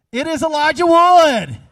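loud, high triumphant announcement; big reveal to the room; excited reveal. Real, not acted. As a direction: proclaim the answer like a game-show host. Revealing the final answer.

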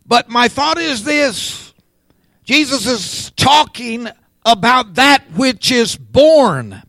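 high, ringing preaching delivery; emphatic preaching. Real, not acted. Preacher telling what Jesus is talking about, emphatic preaching. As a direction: lift the voice and land hard on "born". Preacher resumes at raised pitch.

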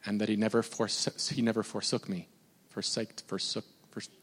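soft, low preaching with long pauses; hushed reflection. Real, not acted. Preacher telling church God never left him, hushed reflection. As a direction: let it sink in quietly.

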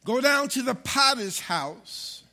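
loud, slow, weighty proclamation; slow down and declare it to the church; emphatic scripture reading. Acted. Pastor reads God's command to the congregation, slow and emphatic.

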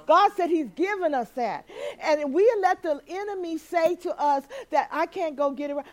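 usual speaking voice

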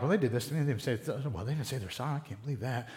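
a hushed, petty church gossip; gossipy murmur. Acted. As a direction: spread it quietly behind backs.

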